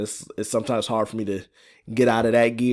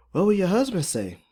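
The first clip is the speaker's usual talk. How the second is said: higher-pitched worried question; mild alarm; 10 semitones higher, 3 dB louder than usual. A narrator's reading. Polly asks Fiona about her husband, mildly alarmed. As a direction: ask with a touch of alarm. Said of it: "Polly asked in alarm".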